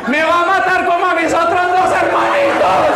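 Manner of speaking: high, loud mock sobbing complaint; hammed-up crying child, loud and high; exaggerated wailing plea. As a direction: overdo the drama to get the neighbours' attention. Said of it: "empezaba a exagerarle los cargos".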